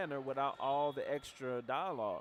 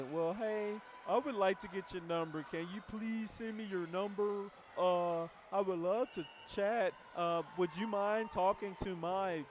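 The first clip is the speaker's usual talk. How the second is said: higher, slowed, overly polite begging; goofy pleading; 5 semitones higher than usual. Retold or imitated. Needy rep begging a prospect for a call, higher and pleading. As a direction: play the needy rep asking too nicely. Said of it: "That's goofy. And that's how you sound".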